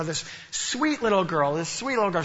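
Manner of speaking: ordinary delivery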